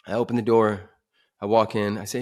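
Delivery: plain reading voice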